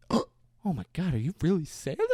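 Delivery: mock awestruck. Baffled man meeting Santa, mock awestruck. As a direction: ask it in hammed-up awe.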